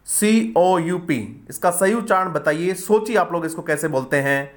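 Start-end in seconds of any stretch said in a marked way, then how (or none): 0.1-1.5 सीओयूपी spelled slowly letter by letter; spelling out letters. Shown as a demonstration. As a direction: spell out each letter of सीओयूपी, slow and deliberate. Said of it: "आप इसको कैसे pronounce करते हैं?". (सीओयूपी के अक्षर धीरे-धीरे एक-एक करके)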